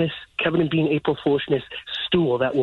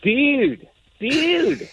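excited; 9 semitones higher than usual. Real, not acted. Winner reached, celebrating.

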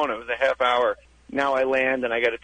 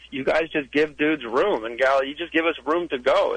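ordinary talk, nothing marked

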